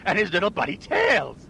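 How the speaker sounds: lightly voiced villain griping about his enemies; blustering villain. Villain complains to a phone company clerk, blustering. Performs an irate robot-owner villain.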